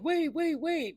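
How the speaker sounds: high-pitched repeated halting cry; mock urgent interruption. Boyd halting them at the path, mock urgent. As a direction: cut in with squeaky mock urgency.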